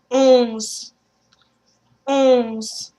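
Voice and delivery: "Onze" as oz, nasal, no N. Shown as a demonstration. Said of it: "pronounce with me".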